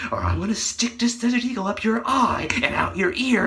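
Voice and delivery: menacing whisper; threatening whisper. Acted. Cop whispering a threat to the criminal. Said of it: "Dick took out his Desert Eagle and says".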